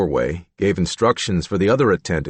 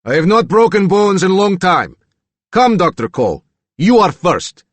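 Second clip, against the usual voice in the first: loud, much higher Slavic threat; angry threat; 11 semitones higher, 7 dB louder than usual. A narrator's reading. Reagan threatens Doctor Cole, angry and loud. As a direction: relish the threat, daring him. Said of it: "the voice of an angry Reagan".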